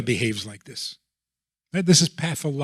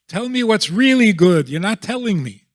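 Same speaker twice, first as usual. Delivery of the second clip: higher, louder mock kid demanding an answer; defiant child; 7 semitones higher, 6 dB louder than usual. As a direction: push back like a fed-up kid. Rebellious child demanding truth.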